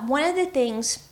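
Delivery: ordinary delivery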